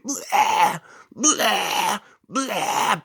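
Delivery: high retching blah sounds; hammed-up retching, high and loud; mock puking noises. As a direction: imitate someone throwing up. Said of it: "She was puking everywhere".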